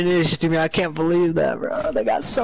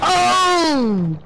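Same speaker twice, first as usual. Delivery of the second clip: surprised cry; 9 semitones higher than usual. Real, not acted. Shocked cry mid-fight.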